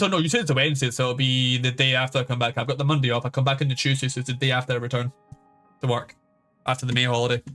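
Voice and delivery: ordinary talk, nothing marked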